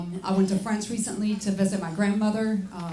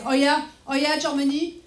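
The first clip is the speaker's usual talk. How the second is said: higher, mock-indignant French accent; critical French onlookers; 5 semitones higher than usual. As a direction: scoff as a disapproving French bystander. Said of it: "French people just stood on the side like".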